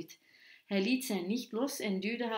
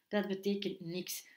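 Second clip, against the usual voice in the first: ordinary delivery, nothing marked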